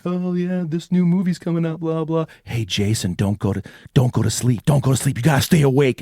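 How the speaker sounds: host chatter that turns into a warning; late night host. Acted. TV host turns to warn Jason, bright then urgent.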